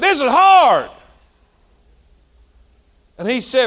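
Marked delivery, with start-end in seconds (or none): 0.0-0.9 high, loud angry cry; blurting out frustration to his coworker; frustrated outburst. Retold or imitated. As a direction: yell it in frustration at the job. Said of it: "And I'm mad".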